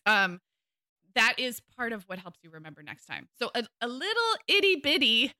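ordinary talk

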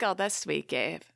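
soft, flat mock politeness; mock polite reply. To Gabe, an unmoved listener's quiet polite reply. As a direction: brush it off with a quiet polite nod.

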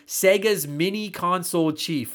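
ordinary delivery

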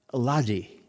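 lightly imitated Glaswegian accent; a touch of Glaswegian Scots; Scottish accent. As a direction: retell it in a Scottish boss's accent. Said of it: "in his Scottish accent".

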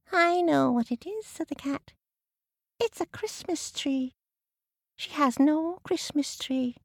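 high cat voice. Acted. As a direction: speak as a clever cat. Cat character.

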